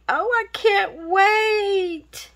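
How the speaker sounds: high, slow excited cry; excited squeal. Real, not acted. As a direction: burst out with happy excitement. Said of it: "Oh, I'm so excited".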